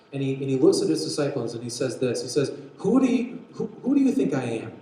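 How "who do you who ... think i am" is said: raised pitch, loud and insistent; emphatic, searching asking; probing question. Acted. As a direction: put the question to a group of followers. Acting Jesus questioning his disciples.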